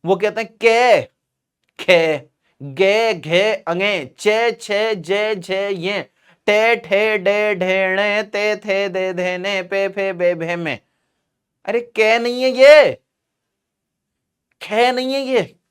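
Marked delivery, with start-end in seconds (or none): 0.5-10.8 Haryanvi-style letters, each ending in long 'e'; Rajasthan-Haryana accent, every consonant drawn out with 'ay'; West UP-Haryanvi pronunciation. Shown as a demonstration. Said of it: "वहां इसका उच्चारण अलग ही चल रहा है". 11.7-15.6 high-pitched protest at a wrong sound; exasperated teacher setting them straight; exasperated correction. Real, not acted. Mock protest at Haryanvi letter sounds.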